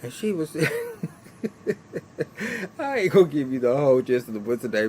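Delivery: usual speaking voice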